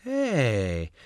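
ordinary delivery

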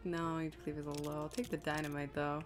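ordinary talk

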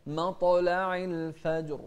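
the ط in مطلع bounced with a clear echo; قلقلة في مطلع; qalqala on مطلع. Shown as a demonstration. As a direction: chant مطلع الفجر with an echoing ط and ج. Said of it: "شوف القلقلة".

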